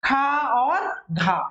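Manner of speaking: खा और घा said very slowly, breathy release; aspirated letters. Shown as a demonstration. Teacher sounding out aspirated letters for students. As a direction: stretch खा and घा, pushing air out. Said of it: "देखिए क्या आएंगे".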